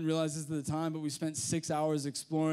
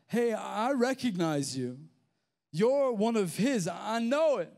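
raised-pitch, insistent accusation; accusing; 5 semitones higher, 4 dB louder than usual. Acted. Onlooker who spotted Peter, accusing. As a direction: call him out as if you've caught him.

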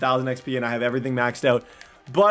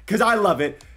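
ordinary talk

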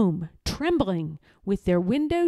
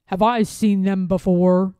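louder wary question; suspicious; 5 dB louder than usual. A narrator's reading. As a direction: probe with suspicion.

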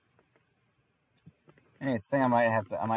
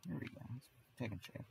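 muttering to self; 3 semitones higher, 14 dB quieter, faster than usual. Real, not acted. Muttering to self during game.

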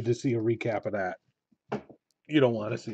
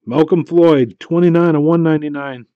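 louder, higher hit announcement; excited reveal; 5 semitones higher, 10 dB louder than usual. Real, not acted. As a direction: celebrate the pull out loud.

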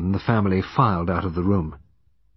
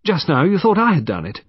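high-pitched defiant accusation; angry, accusing; 10 semitones higher, 7 dB louder than usual. A narrator's reading. Young woman accuses the detective of suspecting her, angrily defiant. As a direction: throw the accusation at him, indignant. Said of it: "She spoke angrily, defiantly, accusing Poirot".

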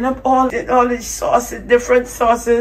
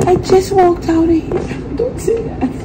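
high-pitched dismay at the extra fee; shocked disbelief; 9 semitones higher than usual. Real, not acted. Vlogger hearing she must pay again, shocked and high. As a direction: squeal it, can't believe it.

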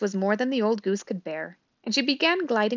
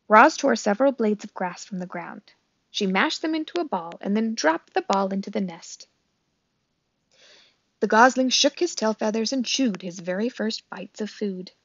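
plain reading voice

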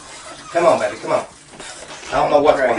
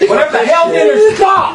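high, loud panicked yell; panicked shout; 10 semitones higher, 8 dB louder than usual. Real, not acted. Chaos as seance turns violent.

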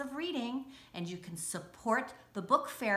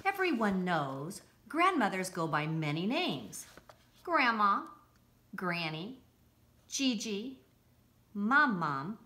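lively picture-book read-aloud; bright, bouncy storybook narration; reading to children. Acted.

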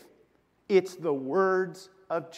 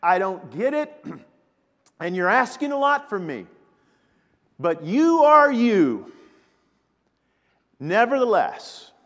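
loud, emphatic declaration of weary resolve; Simon Peter; 3 semitones higher, 8 dB louder than usual. Acted. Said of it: "that's what Simon Peter is saying".

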